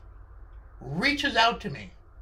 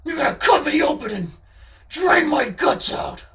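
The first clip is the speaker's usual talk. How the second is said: high, loud, paranoid accusation; loud, raised menacing character voice; Charlie's menacing voice; 7 semitones higher, 7 dB louder than usual. Acted. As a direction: accuse them in a menacing put-on voice. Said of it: "that voice does sound menacing".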